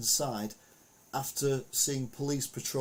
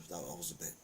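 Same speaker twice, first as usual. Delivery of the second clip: ordinary talk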